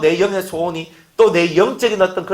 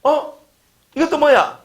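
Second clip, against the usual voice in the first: surprised passerby; 8 semitones higher than usual. Retold or imitated. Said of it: "지나가는 일본 사람 듣고".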